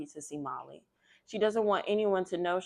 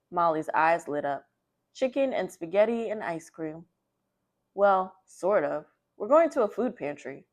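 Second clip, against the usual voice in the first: lively, louder picture-book read-aloud; reading to children; 6 dB louder than usual. A narrator's reading. Librarian reading a picture book to children, lively and loud. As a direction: read it out brightly to the kids.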